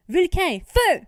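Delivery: high, very loud battle command; shout. Acted. Rory ordering her dragon to attack, full shout. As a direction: bark the order to breathe fire. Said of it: "Rory crie".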